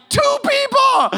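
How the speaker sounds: very high, loud, stretched-out squeal; triumphant outburst. Retold or imitated. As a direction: crow it in an immature squeal of victory. Acts out his triumphant outburst.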